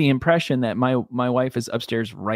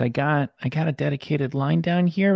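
normal talking voice

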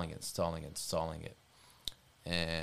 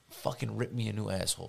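normal talking voice

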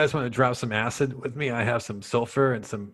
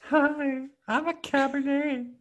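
very high falsetto; airheaded high-pitched bridesmaid; wine-loving maid of honor; 12 semitones higher, slower than usual. Acted. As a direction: coo it in a squeaky put-on voice. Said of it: "she drinks a lot of wine".

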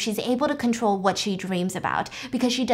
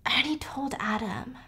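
whispered, much softer aside; hushed whisper; 10 dB quieter than usual. Retold or imitated. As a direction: lean in and whisper the bad news. Ex-husband drops news quietly.

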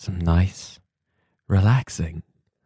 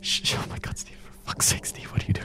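hushed aghast scolding; shocked whisper; 8 dB quieter, faster than usual. Real, not acted. Appalled at Steve insulting Americans, whispered. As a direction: whisper your disbelief.

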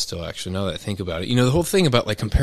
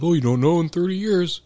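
lightly voiced know-it-all skeptic, higher; a hint of a smug doubter's whine; mocking skeptic voice; 7 semitones higher than usual. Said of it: "the argument is always like".

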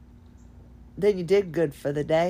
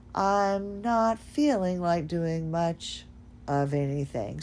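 very slow, soft, drawn-out voice; mother-in-law imitated, dragging out a tired slow ramble; imitating slow-talking mother-in-law; 2 semitones lower, 4 dB quieter, slower than usual. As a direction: drag every word out like she does. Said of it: "she talks like this".

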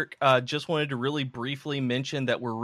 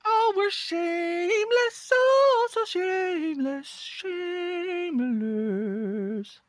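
mock falsetto; slower than usual. Mocking self-promotion.

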